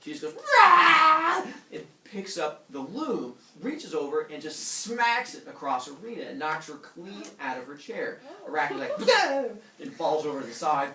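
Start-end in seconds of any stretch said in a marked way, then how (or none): 0.3-1.4 huge high roar; furious roar. Retold or imitated. Athena snaps. 9.0-9.4 loud high yelp; pained yelp. Retold or imitated. Arachne gets smacked.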